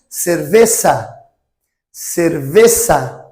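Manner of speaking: cerveza with a hissed s, not a z, slowly; Spanish z as s. Shown as a demonstration. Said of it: "é um som de C cedilha".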